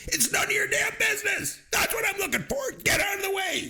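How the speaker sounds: high-pitched, strained movie-character shout; imitated yell. Movie fan acts out a character telling onlookers off, yelling. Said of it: "And he yells".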